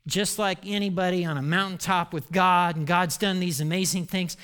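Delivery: ordinary talk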